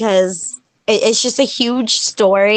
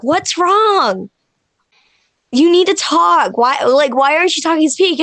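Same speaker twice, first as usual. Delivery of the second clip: higher-pitched, insistent questioning; retold worried husband, pitched up; alarmed husband; 5 semitones higher than usual. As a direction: imitate a husband urgently demanding an answer. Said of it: "And he's like".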